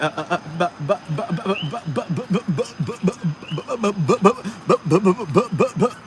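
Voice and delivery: imitating a caught-out girl sputtering excuses; flustered stammering. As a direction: stammer "but, but" in a panic, building. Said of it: "Eunice is gonna be like".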